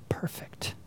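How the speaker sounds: breathy whispered aside; hushed whisper of self-admiration. Acted. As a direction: whisper it to yourself, savoring it. Preacher whispers mock self-praise.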